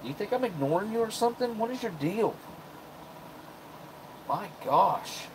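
high-pitched voice to a cat; pet talk. Real, not acted. Talking to the crying cat.

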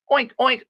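high piggy oinking; playful pig imitation; pig oinks. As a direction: oink like a little pig.

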